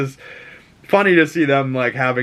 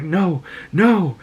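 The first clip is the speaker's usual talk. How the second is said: higher alarmed no; a lightly voiced panicked Scott; mock horror; 6 semitones higher than usual. Scott seeing his son attack Jean, mildly panicked. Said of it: "and he's like".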